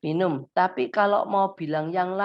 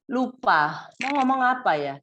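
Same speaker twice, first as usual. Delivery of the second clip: usual speaking voice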